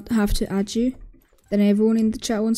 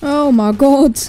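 sudden loud jump-scare gasp; startled shout; 4 semitones higher, 9 dB louder, much slower than usual. Real, not acted. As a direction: blurt it out, really startled. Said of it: "He scared me".

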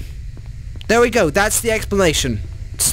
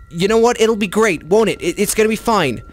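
ordinary delivery

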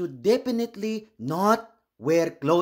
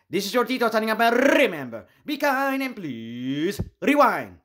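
cheerful sign-off; 4 semitones higher than usual. Acted. Show host signs off.

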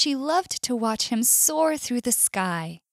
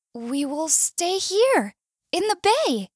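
slow, higher, brightening announcement; hopeful excitement; 9 semitones higher, 4 dB louder than usual. A narrator's reading. Whale proposing a home for both, hopeful and excited. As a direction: draw it out, pitch climbing hopefully. Whale offers a happy solution.

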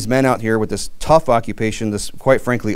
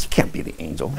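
laughing through a teasing reply, quieter and lower; 7 semitones lower, 7 dB quieter than usual. Real, not acted. Teasing his wife, laughing as he says it. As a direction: chuckle the words out.

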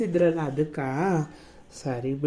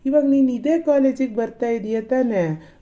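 ordinary talk, nothing marked

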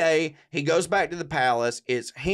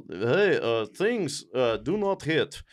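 put-on halting, stilted Russian accent; mock Russian soldiers; 2 semitones lower than usual. Soldiers confronting the Tsar at his palace, mock Russian accent. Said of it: "the group of soldiers comes up, and they're just like".

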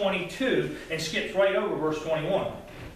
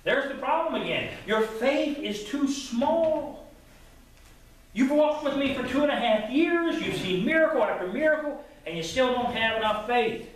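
rising preacher's rebuke, pitched up; preaching rebuke; 6 semitones higher than usual. Acted. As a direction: press the point hard, preacher-style. Preacher presses Jesus' rebuke.

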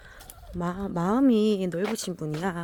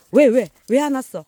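loud, high puzzled call; loud high why-won't-you-come call; calling to the dog; 6 semitones higher, 10 dB louder than usual. Real, not acted. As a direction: shout the question at the dog. Asking dog why it stopped.

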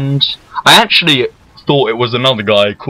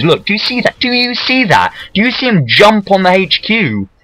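high, insistent outrage; indignant; 8 semitones higher than usual. Real, not acted. Gamer showing viewers his rival's betrayal, indignant. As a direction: demand that they look, voice pitched right up.

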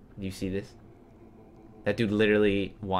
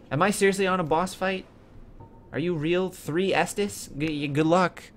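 higher, louder, incredulous; alarmed disbelief; 8 semitones higher, 3 dB louder than usual. Real, not acted. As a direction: caught off guard by a boss. Panicked at surprise boss.

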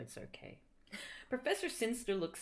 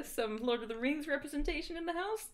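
usual speaking voice

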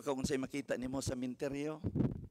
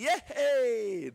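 mock cheer; 6 semitones higher, 4 dB louder than usual. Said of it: "Di mo ningoy".

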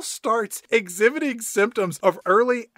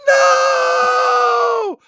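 long, high, loud wail; drawn-out hammed-up shriek; mock horrified scream; 11 dB louder than usual. Mock horror at spooky reveal.

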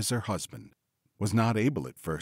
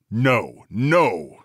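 loud villainous husband's no; forceful refusal; 7 dB louder than usual. A narrator's reading. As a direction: refuse her loudly and firmly. Bluebeard refuses her prayer.

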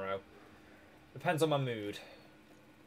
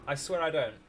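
ordinary delivery

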